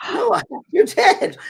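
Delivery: high, loud mock child's voice; insistent little girl. Child insists to disbelieving Mary.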